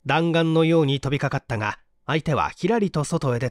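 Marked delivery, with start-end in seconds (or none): none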